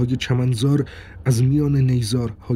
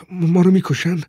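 put-on higher, alarmed child's cry; frightened child; 6 semitones higher, 4 dB louder than usual. A narrator's reading. Child telling father they will be killed, frightened. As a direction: blurt it out in fear, pitched up.

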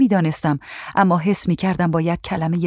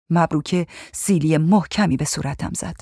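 ordinary delivery, nothing marked

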